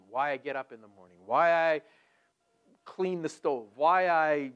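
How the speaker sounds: normal talking voice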